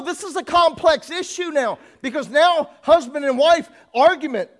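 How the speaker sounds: loud, high preaching cadence, drawn out; full-force preaching. Acted. Preacher hammering at the congregation, loud and high.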